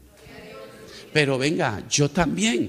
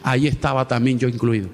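fervent preaching; 3 semitones higher, 5 dB louder than usual. Acted. Pastor tells the church about the repaid loan, fervent preaching. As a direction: preach it, higher and driving.

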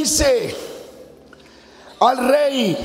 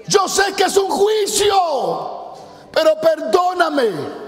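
pleading prayer shouted high and slow; crying out; 4 semitones higher than usual. Acted. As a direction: cry out to God for mercy. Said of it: "comienza a gritar". (clamor suplicante, agudo y lento)

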